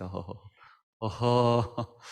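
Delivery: imitating the pastor's easy ho-ho laugh; lightly voiced, cheerful chuckle; mock jolly laugh. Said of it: "se ríe".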